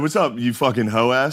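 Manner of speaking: slow, punchy retold phone greeting; playful, full-energy insult hello; energetic greeting. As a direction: greet a buddy with a crude tease. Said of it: "didn't respond with the same energy".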